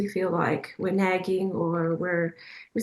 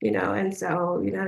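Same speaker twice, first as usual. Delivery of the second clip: ordinary talk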